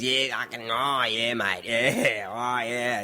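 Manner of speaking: drawled bogan tradie impression; bogan Aussie. Japanese mate who learnt English from tradies, bogan Aussie drawl. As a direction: imitate a bogan Aussie with stretched-out yeahs. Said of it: "the English he does know is bogan Aussie".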